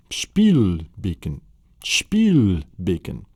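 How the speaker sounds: Spielbecken drawn out slowly syllable by syllable. Shown as a demonstration. Teacher slowly spelling out Spielbecken for learners, drawn out. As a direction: stretch each part of Spielbecken. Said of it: "The German word for kitchen sink is".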